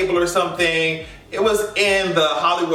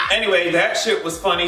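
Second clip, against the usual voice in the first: ordinary delivery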